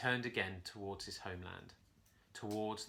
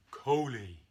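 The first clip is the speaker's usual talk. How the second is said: gentle roar; 7 dB louder than usual. A narrator's reading. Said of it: "A voice gently roared".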